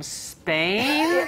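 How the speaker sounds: SPAIN drawn out long; incredulous questioning. Retold or imitated. Actress querying an audition request, drawn-out incredulous Spain. Asks if they mean Castilian Spanish.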